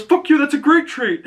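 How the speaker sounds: a squeaky proud comeback; defiant Peacemaker. Retold or imitated. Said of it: "And he goes".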